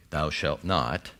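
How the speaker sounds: slow, low stern commandment; a hint of an angry god laying down the law; angry deity. Preacher voices an angry deity forbidding, slow and stern. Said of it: "rather than hearing an angry deity".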